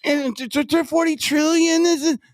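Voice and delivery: high, stammering whine; mock a flustered, whiny politician; DeSantis. Co-host imitating the candidate on the border, whiny stammer. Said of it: "It's like this".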